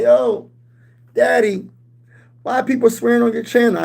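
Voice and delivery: put-on high, eager kid asking dad; son's voice. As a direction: play the young son asking. Father voices son's question.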